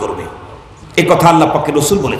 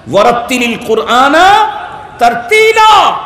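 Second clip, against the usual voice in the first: commanding shout; 5 semitones higher than usual. Shown as a demonstration. Preacher repeats God's command to the crowd, shouting. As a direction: thunder out "তারতিলা" as a divine order. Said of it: "আমার order".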